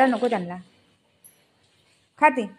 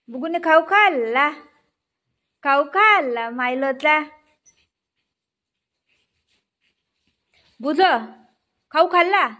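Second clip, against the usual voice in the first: higher, sing-song praise for the pet; pet talk; 5 semitones higher than usual. Real, not acted. Owner praises pet for eating the treat, higher sing-song coo.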